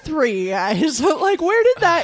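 loud, high laughing outburst; excited laughing. Real, not acted. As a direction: burst out laughing loudly.

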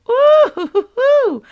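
high, loud joyful hoots; a happy laughing whoop; preacher whooping with laughter; excited whoop. Real, not acted.

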